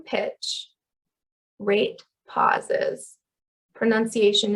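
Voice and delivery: normal talking voice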